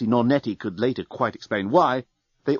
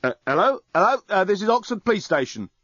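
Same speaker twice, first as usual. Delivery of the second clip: tinny voice over a phone, insisting; insistent phone voice; 8 semitones higher than usual. A narrator's reading. Police officer on the mobile insists someone answer, over the phone. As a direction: press them to answer, through the receiver. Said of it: "insisted his mobile phone".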